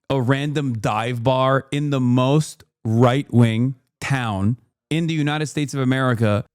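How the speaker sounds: ordinary talk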